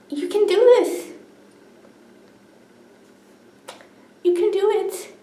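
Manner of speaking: high-pitched voice of someone cheering you on; acted-out high, encouraging quote. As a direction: lift the voice high, imitating an encourager. Voices people who encouraged her.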